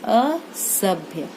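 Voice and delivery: very slow, stretched असभ्य, each syllable held; dictating one word at writing speed; drawn out while writing. Shown as a demonstration. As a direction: draw out असभ्य slowly as you write it. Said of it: "तो हम कैसे बनाएंगे देखिए".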